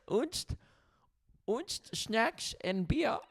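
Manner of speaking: high, slow nonsense German accent. Shown as a demonstration. Host improvising on request, in a high mock German accent. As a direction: fake German, drawn out slowly. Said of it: "All right, German".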